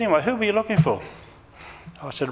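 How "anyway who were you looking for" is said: lady on the phone. Retold or imitated. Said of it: "She said".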